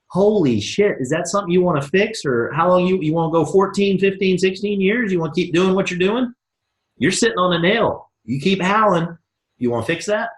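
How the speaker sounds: high, needling push; mock shocked. Coach acting the pitch to a prospect, high and pushing. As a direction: press the prospect teasingly.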